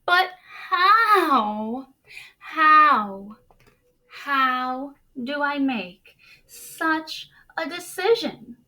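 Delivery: loud, high anguished plea; despairing cry. A narrator's reading. Jameson in despair cries out how to decide. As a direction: cry out in despair over the choice. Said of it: "Jameson sank in despair".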